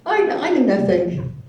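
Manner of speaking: high, loud mock-innocent playing dumb; feigned innocence. Using her deafness to dodge her sister, mock innocent. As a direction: play the clueless deaf card. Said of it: "I just used my deaf card".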